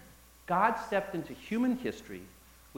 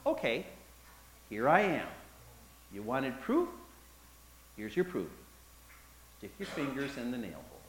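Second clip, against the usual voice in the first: gentle Jesus; 5 semitones lower, 6 dB quieter than usual. Acted. Jesus turning quietly to Thomas, tender and calm. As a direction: lower and softer, calm.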